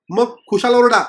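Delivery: retorting loudly. Acted. Wife loudly tells the groaning writer to go ahead and shout. Wife snapping back at husband.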